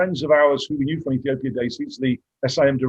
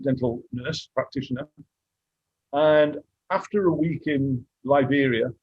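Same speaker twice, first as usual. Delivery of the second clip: ordinary talk, nothing marked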